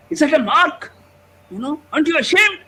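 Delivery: high, loud, fast berating; scolding shout. Retold or imitated. Father scolding the boy over his maths mark, shouting. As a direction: tear into him for a ninety-four.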